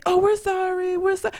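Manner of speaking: mock apologetic mom.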